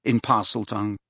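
plain reading voice

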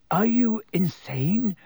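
high, slow, slightly croaky disbelief. A narrator's reading. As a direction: exclaim it with a touch of croak.

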